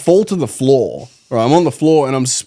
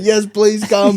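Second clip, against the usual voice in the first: high, pleading retold voice; lightly acted desperation; desperate pleading; 9 semitones higher, slower than usual. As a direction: plead up high for someone to come. Retold desperate plea for help.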